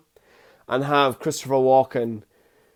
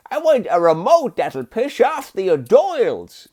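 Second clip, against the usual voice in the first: higher-pitched mock movie-character voice; Adam Sandler; 7 semitones higher, 3 dB louder than usual. Vlogger quoting a line from Click, mimicking Adam Sandler. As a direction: put on a high, drawn voice. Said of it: "when Adam Sandler was like".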